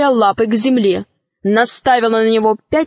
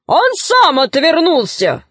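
high, drawn-out retort; shouting; 6 semitones higher than usual. A narrator's reading. Robbers argue for the dragon, high drawn-out shout. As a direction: holler the excuse back. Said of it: "закричали разбойники".

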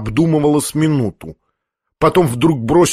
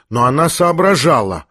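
ordinary delivery

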